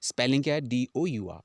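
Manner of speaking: ordinary talk, nothing marked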